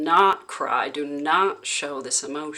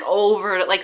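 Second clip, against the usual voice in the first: ordinary talk, nothing marked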